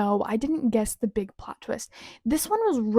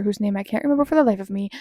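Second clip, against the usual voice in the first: ordinary talk, nothing marked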